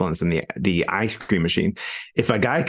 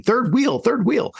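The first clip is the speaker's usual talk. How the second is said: mock outraged squeal; 14 semitones higher, slower than usual. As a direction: squeal it like an offended diner. Mock outrage at hovering waiter.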